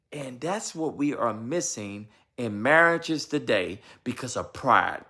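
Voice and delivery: ordinary talk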